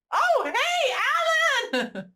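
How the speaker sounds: high-pitched excited shout; excited greeting. Real, not acted. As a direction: squeal a delighted hello at someone you like. Greets character on screen.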